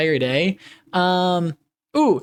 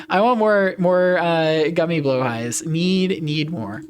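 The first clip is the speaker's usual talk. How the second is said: ordinary talk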